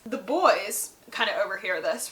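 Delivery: normal talking voice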